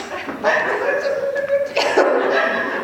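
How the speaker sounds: real high squealing laugh; helpless high laughter; high wheezing laugh. Real, not acted. Laughter at own story.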